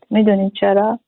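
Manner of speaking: normal talking voice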